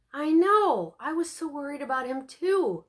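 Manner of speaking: eager agreement. A narrator's reading. Friend answers with feeling.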